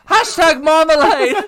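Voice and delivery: high, loud cheeky cockney bear; Paddington. Pretend. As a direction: squeak it out like a cheeky youth. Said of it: "Paddington was like".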